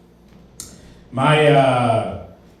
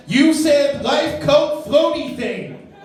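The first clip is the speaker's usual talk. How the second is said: mocking callback; 12 semitones higher, much slower than usual. Mimicking friend in every argument.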